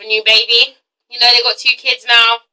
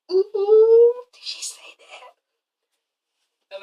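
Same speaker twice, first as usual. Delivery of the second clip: whispered eavesdropper gasp; gossipy whisper; 9 semitones higher, much slower than usual. Retold or imitated. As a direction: whisper like a nosy onlooker. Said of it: "photographers were listening in".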